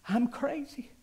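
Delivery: high, anguished wail of despair; screaming to God. Retold or imitated. Man alone on the floor pleading with God, screaming in anguish. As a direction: cry out desperately, pitch climbing high. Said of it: "started screaming".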